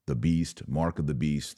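ordinary talk

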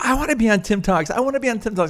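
put-on high, fast little-kid voice; imitating an eager small grandson; child voice. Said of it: "and he goes".

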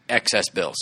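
ordinary delivery

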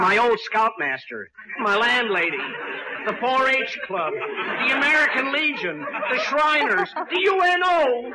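comic crescendo of exasperation; building to shouting. Acted. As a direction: escalate from loud complaint to a high, stretched shout. List of objectors builds absurdly.

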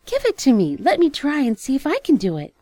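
high, loud eager pleading; cartoon princess. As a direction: beg to try it, excitedly. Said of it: "cried the princess".

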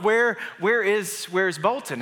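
stammered, drawn-out worried question; retold panic. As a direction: act out a dad searching frantically. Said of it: "I began to panic".

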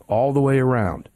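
ordinary delivery

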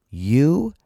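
very slow, emphatic delivery; imitating a mentor's firm insistence. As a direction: land each word firmly. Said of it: "he said it very firmly".

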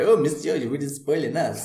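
cheesy advert line in bad French accent; terrible French accent. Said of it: "put on a terrible French accent".

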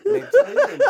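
breaking into loud laughter mid-word; cracking up while listing; laughing. Real, not acted. Telling the partner of his tiring gigs, laughing loudly.

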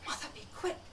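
urgent hushed whisper. Acted. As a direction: breathe it out secretly, in a rush. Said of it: "she whispered to her mother".

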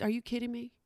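ordinary delivery

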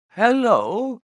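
high, startled call-out; surprised cry. A narrator's reading. Doctor startled by a sight on the moor, crying out high. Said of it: "cried Doctor Mortimer".

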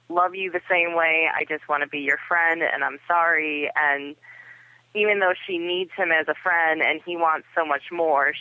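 normal talking voice

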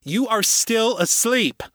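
high, slow, emphatic call; insistent high-pitched warning; loud voice. A narrator's reading. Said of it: "the loud voice said".

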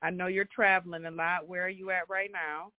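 ordinary talk

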